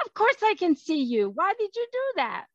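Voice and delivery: high, exasperated retort; affronted child's comeback; indignant boy. Acted. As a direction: demand an explanation, pitch climbing. Boy challenges the ghost.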